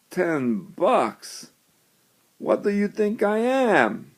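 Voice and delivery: high, loud, offended outcry; indignant. Retold or imitated. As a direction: voice the woman's outraged protest. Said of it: "she says".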